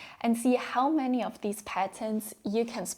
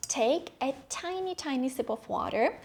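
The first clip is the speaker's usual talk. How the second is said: normal talking voice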